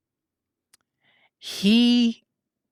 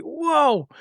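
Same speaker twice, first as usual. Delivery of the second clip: a high, stunned "Whoa"; amazed whoa; 10 semitones higher than usual. Retold or imitated. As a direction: gasp out your amazement. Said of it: "I was like".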